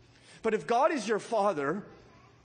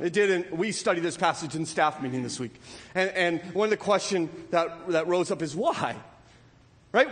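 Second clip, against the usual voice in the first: ordinary delivery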